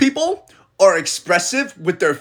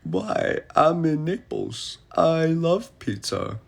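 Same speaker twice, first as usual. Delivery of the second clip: softer, slow, a put-on pleading voice; whiny tourist; 6 dB quieter, slower than usual. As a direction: beg like a disappointed tourist. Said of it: "And I was like".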